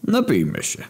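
high-pitched little proposal; perky drinker's suggestion; tipsy suggestion. Acted. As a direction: propose a drink in a merry, silly voice. Drunk secretary suggests a drink.

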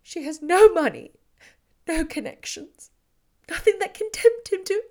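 distraught. Acted. Elizabeth telling Darcy of the elopement, distraught and breaking. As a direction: confess the disaster, voice breaking.

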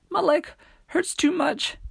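put-on very high, pained girl's cry; shouted. A narrator's reading. Millie unable to keep up, high pained shout. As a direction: cry out high from a hurt leg. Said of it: "Millie shouted".

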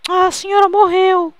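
high, drawn-out hammed-up lament; mock grief. Prank father deciding the silent healer has died, mock grief.

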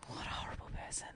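fast breathy whisper. Real, not acted. Woman whispering about the ex who dumped her. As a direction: hiss it under your breath.

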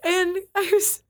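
high, loud words broken up by laughter; can barely get it out for laughing; laughing while talking. Real, not acted. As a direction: talk through a fit of giggles. Recalling a funny movie scene.

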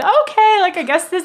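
high, loud airhead going along; clueless ditzy voice. Retold or imitated. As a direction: sound blindly trusting, way up high. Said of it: "I'd be".

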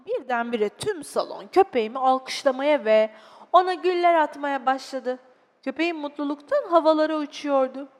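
expressive, dramatised storytelling; children's story narration. Acted. Narrator telling children of a sad little dog, expressive storytelling. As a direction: read it to small children with feeling.

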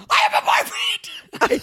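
very high, drawn-out shriek; high-pitched explosive scream; screamed outburst. Retold or imitated. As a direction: let it rip out of you in a squealing scream. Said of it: "I'm sorry that I screamed that".

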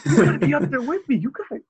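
very high, laughing frantic pleading; hammed-up frantic groom; panicked groom. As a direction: squeal that you must get married now. Groom frantically leaving the hug.

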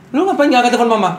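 high, loud, fast scolding mother; hammed-up panicked mom demanding an answer. (omelan ibu yang panik, tinggi dan keras)

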